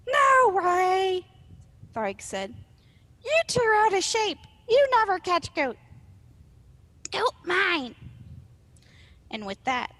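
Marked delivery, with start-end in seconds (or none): 0.1-1.3 high, loud troll protest; defiant small troll. Acted. Small troll argues back at Arg, high defiant squawk. 3.2-7.9 high, loud, quarrelsome troll voice; taunting small troll. Acted. Small troll taunts Arg over the goat, high jeering voice.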